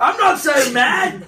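high, slow mock-indignant Cockney; Cockney accent. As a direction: protest in exaggerated Cockney. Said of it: "you look so bloody mad".

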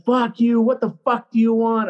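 high, heated retold challenge; angry coach. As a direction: imitate a fed-up coach firing back.

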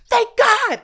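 loud high cry of relief; mock relief, loud and high; relieved cry. Relief on hearing it's a boy.

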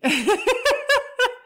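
a sudden high giggle burst; high burst of laughter. Real, not acted. Laughing at her own naughty joke, high giggle. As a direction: laugh out loud, high and bright. Laughing after "night after smelly.

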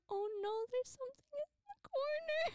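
high-pitched retold panic; imitated middle-of-the-night fright; frightened reaction. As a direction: act out dread in a thin high voice. Retells waking scared from nightmare.

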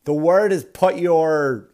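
loud, slow director correcting an actress; exasperated director. Acted. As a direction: call cut, then spell it out slowly. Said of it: "and the director went".

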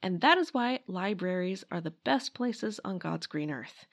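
ordinary delivery

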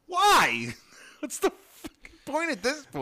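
squeaky incredulous outburst; high exasperated voice. Pretend. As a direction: shriek it in disbelief.

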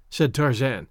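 ordinary delivery, nothing marked

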